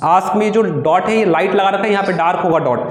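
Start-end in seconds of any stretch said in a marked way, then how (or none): none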